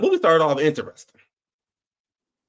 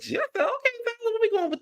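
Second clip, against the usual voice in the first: much higher, pleading disbelief; exasperated; 4 dB quieter than usual. Real, not acted. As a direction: question the director, incredulous. Talking to the director.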